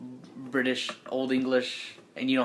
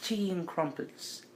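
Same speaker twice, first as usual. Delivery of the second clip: slow, higher, softer attempt at British; British accent; 5 semitones higher, 9 dB quieter, slower than usual. Shown as a demonstration. Game master testing his British accent, slowly. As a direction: try out a British accent, drawn out. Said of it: "I tried to do it".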